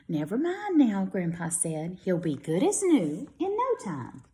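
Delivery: lively storybook read-aloud; children's story voice for young listeners. A narrator's reading. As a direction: read it brightly to a class of kids. Reads picture book to children.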